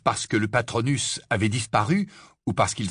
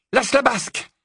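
high, firm incantation; cast spell; 4 dB louder than usual. Acted. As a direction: call out the spell with authority. (formule lancée d'une voix aiguë)

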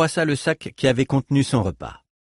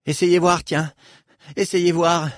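défi nerveux; 7 semitones higher, 3 dB louder than usual. A narrator's reading. Reich sur la défensive.

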